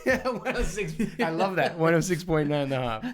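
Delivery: high laughing repeat. Real, not acted. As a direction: giggle through the words. Laughing at the radio joke.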